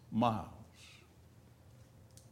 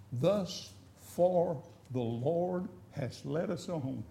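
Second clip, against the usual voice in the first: slow and quiet, Thus far drawn out; hushed solemn testimony; 4 semitones lower, 5 dB quieter, much slower than usual. Real, not acted. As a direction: savor each word like a blessing.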